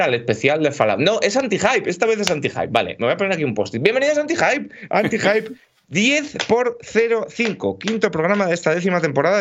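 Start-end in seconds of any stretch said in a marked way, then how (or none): 3.8-9.4 show host intro. Acted. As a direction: welcome the audience like a show opener, stretching it out. Opens the show.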